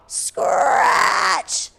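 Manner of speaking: breathy scratching noise; rasping scratch sound. A narrator's reading. Reading the monster noise to the class, a long scratch. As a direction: claw the word out. Sound effect in picture book.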